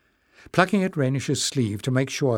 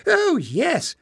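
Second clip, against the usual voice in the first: put-on much higher, eager old man; old librarian Joseph. Old librarian confirms to the king, high and eager. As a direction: chirp it out eagerly.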